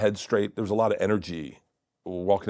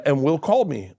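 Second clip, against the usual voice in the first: usual speaking voice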